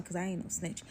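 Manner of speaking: plain reading voice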